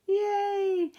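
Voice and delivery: high, bright cheer; cheerful yay. Real, not acted. Teacher cheering with her class to smile. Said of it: "Everybody smile".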